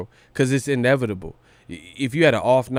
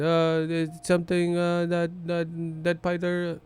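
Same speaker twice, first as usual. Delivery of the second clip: put-on higher, slow Filipino accent creeping in; slipping into Pacquiao accent; 6 semitones higher, 5 dB quieter, slower than usual. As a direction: let the Pacquiao accent leak out mid-sentence. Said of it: "I'll be turning into my accents".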